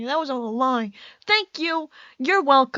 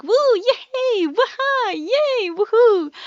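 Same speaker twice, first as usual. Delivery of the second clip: much higher, squealy cheering chat; mock cheering; 9 semitones higher, 3 dB louder, much slower than usual. Stream chat cheering her refresh trick, high squealing cheers. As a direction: cheer like the whole stream at once. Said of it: "everybody's like".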